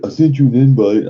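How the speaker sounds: high, loud mock voice of the friend. Mimicking the friend's game invite, high and loud. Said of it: "he's like".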